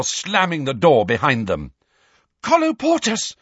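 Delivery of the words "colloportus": high, urgent gasp of an incantation; gasped spell. A narrator's reading. Hermione sealing the door behind them, gasped. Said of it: "gasped Hermione".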